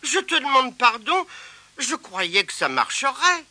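high, sorry little voice; apologetic spider. Acted. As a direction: Abigail sorry to Tirondin.

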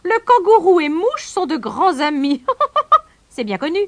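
high, loud kingfisher with a laugh; gleeful high-pitched teasing; mocking laughter. A narrator's reading. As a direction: proclaim it, cackle, then add the jibe. Same kingfisher keeps sniggering.

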